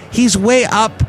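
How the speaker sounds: ordinary talk, nothing marked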